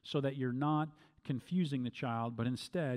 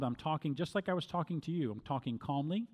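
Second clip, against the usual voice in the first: usual speaking voice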